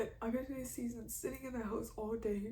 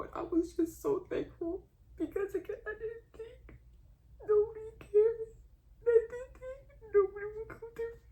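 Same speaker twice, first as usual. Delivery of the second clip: very high, tearful voice; real crying; 11 semitones higher, 5 dB louder than usual. Real, not acted. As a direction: let it climb as the tears take over. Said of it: "I'm so sorry I'm doing this".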